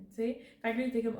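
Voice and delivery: ordinary talk, nothing marked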